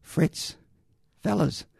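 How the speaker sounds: half-acted call of a lost soldier looking for his mates; nervous calling out. Lost German soldier calling for his mates, mock nervous call. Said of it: "just sort of nervously calling out for".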